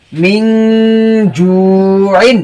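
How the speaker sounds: মিন held as a long "ming" hum, then জুইন drawn out; hidden n, ghunnah. Shown as a demonstration. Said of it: "গোপন হবে, গুণ্য হবে".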